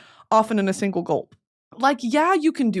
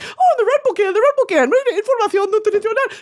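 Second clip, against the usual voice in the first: frantic self-quote; 13 semitones higher, 6 dB louder than usual. Retold or imitated. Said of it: "I'm like".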